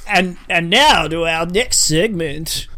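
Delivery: booming hammed-up quiz show intro; mock game show host.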